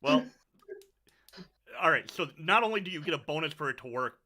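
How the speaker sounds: ordinary talk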